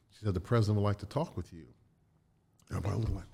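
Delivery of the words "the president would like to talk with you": usual speaking voice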